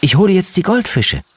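put-on high eager child voice; a little boy calling out keenly. A narrator's reading. Little boy calling to his family, high and eager. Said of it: "rief Stefan".

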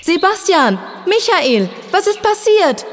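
screaming into the cave. A narrator's reading. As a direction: yell down into the hole for your friends. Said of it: "schrie er in die Höhle hinein".

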